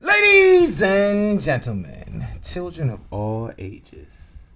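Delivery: slow grand ringmaster call. Pretend. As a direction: announce like a circus ringmaster. Ringmaster opening the show.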